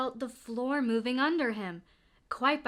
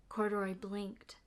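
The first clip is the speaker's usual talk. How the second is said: usual speaking voice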